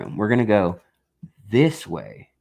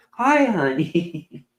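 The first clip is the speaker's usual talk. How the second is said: higher, drawn-out greeting; slow, high hello to a pet; fond pet greeting; 7 semitones higher, much slower than usual. Real, not acted. Owner greets the cat mid-video, fond pet greeting.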